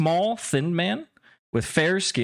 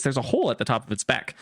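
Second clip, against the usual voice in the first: normal talking voice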